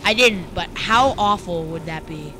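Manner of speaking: normal talking voice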